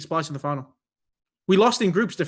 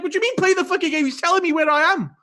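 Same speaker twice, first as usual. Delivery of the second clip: high, fast, indignant pleading; retold exasperated protest; 11 semitones higher, 4 dB louder than usual. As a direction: re-enact an outraged complaint to referees. Retold argument with the refs.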